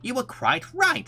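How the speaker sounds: Rabbit's voice. A narrator's reading. Rabbit admits Pooh was right, high and slow. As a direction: switch up into a high character voice. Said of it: "wrong character".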